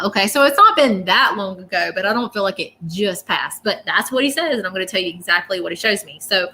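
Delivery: ordinary delivery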